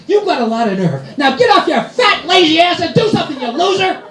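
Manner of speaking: high shrill mock rant, rising to a yell; mock angry wife. Wife yelling at husband on the machine, hammed-up anger. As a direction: lay into him. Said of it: "She actually yelled at me".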